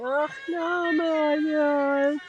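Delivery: tender nonsense syllables, coo-like; loving gibberish. Shown as a demonstration. Instructor speaking lovingly in gibberish to her own self. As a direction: coo made-up sounds to yourself with love. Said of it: "loving on yourself with gibberish".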